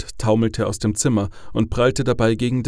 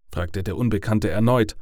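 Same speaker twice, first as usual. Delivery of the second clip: ordinary delivery, nothing marked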